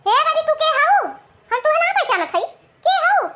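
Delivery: high-pitched, suspicious challenge; indignant mother-in-law. Acted. Mother-in-law not recognising her daughter-in-law, indignant high-pitched challenge. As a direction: demand sharply who this stranger is.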